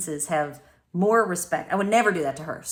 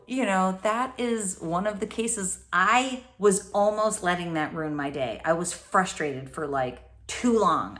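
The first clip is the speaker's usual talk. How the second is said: ordinary talk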